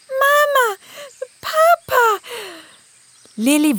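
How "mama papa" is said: high, joyful little unicorn; greeting her parents; young girl voice. Acted.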